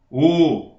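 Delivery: closed "o", held and round; closed o vowel. Shown as a demonstration. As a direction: sustain a closed "o". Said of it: "eu posso falar". ("o" fechado e sustentado)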